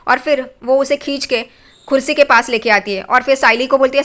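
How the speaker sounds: ordinary talk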